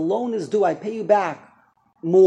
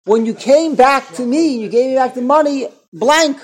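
much louder, higher, hammering each word; emphatic loud; 5 semitones higher, 11 dB louder than usual. Acted. Rabbi driving the point home to students, loud and emphatic. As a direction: pound out each cold word. Rabbi dramatizes the cold repayment.

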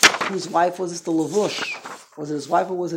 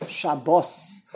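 "Shabbos" ending strong, sha-BOS; Hebrew last-syllable stress; 3 semitones lower than usual. Shown as a demonstration. Teacher models the blessing's last word, "Shabbos" stressed on "-bos". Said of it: "You're saying it in Hebrew".

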